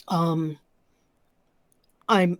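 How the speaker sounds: ordinary talk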